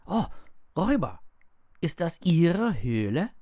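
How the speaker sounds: high, loud excited cry; calling out. A narrator's reading. Heinrich springs up to the shepherd and calls out eagerly. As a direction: burst out with a child's eager question. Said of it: "sprang zu ihm und rief".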